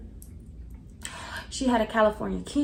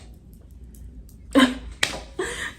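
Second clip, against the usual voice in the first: laugh; 12 semitones higher than usual. Real, not acted. Ends the story laughing.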